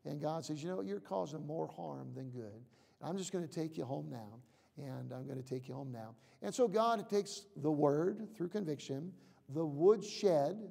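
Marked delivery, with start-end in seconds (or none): 0.6-6.1 soft, gentle and unhurried, quieter; a loving father bringing a child home; tender voice of God. Retold or imitated. Preacher voicing God gently calling his children home, softly.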